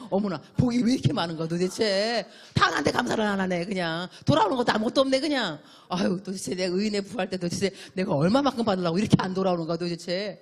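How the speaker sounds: loud, laughing mock joy at being unthanked; hammed-up delight. Preacher to congregation, playing a loud, laughing, overjoyed believer. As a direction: gush loudly, laughing, as if each snub were a gift. Acted exaggerated delight at no thanks.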